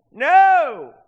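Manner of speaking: high, very loud refusal; acted-out defiant holler; defiant shout. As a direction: belt it out as a stubborn retort. Grandmother's retort to hollering nurses, acted.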